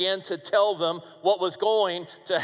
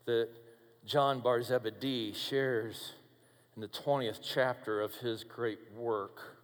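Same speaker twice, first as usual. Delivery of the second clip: quiet narration; 7 semitones lower, 7 dB quieter than usual. Acted. Preacher quietly leading back to the empty tomb. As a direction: lower and softer storytelling. Quiet storytelling return to cemetery.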